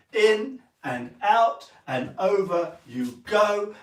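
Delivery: slow, sing-song calling of the steps; slow called steps. Shown as a demonstration. Teacher calling the boys' steps slowly in time. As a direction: call the moves slowly on the beat. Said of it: "So we go again for the boys".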